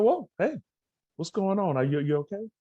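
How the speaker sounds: sudden high, concerned check-in; alarmed but gentle, asking what's wrong; concerned whoa. Retold or imitated. Coach checks on crying girl.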